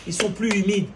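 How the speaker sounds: impassioned Friday sermon at full force; fiery preaching. Acted. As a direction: preach it hard to the congregation. Preacher exhorts the congregation.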